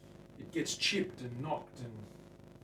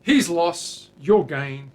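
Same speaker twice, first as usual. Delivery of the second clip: loud, higher announcer pitch; mock sales pitch; 5 semitones higher, 17 dB louder, much slower than usual. Pitching the guitar to buyers, loud mock announcer. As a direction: sell it like an ad.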